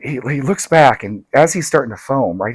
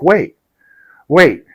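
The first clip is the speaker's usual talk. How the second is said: urgent call; 5 semitones higher, 11 dB louder than usual. Retold or imitated. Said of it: "the scorpion's like".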